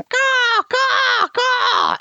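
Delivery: very high squawking caw; bird caw. Acted. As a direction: screech like a startled bird. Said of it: "the bird starts going".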